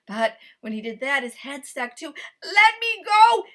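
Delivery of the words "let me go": higher, louder desperate shout. A narrator's reading. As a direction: yell to wake the house. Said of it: "shouted Kui so loudly".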